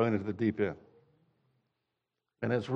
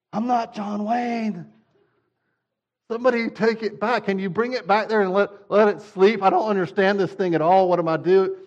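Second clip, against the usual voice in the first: panicked new parent; 6 semitones higher than usual. Retold or imitated. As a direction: plead to take the baby back. Panicked new dad with baby.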